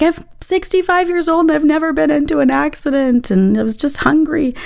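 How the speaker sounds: high-pitched pleading excuse; imitating the other driver. Driver excusing himself after running a red light, mock plea. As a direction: mimic a defensive older driver's lame excuse, pitch up. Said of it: "he was like".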